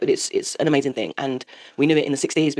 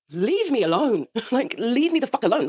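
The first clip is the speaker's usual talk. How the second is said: higher, half-laughing exasperation; exasperated plea; 7 semitones higher than usual. Acted. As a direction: plead to be left alone, higher-pitched. Acted exasperated plea to sons.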